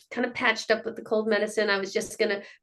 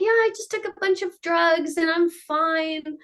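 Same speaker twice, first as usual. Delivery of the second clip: put-on higher-pitched kid quote; retold child's reply in a raised voice; imitating young daughter; 7 semitones higher than usual. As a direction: lightly mimic a child answering, pitched up. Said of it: "She's like".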